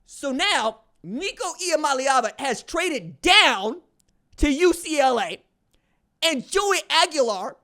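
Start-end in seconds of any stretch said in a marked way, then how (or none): none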